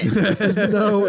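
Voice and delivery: usual speaking voice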